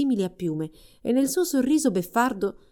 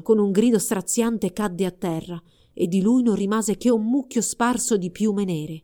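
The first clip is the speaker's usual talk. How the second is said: plain reading voice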